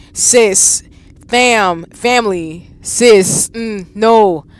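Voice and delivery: ordinary delivery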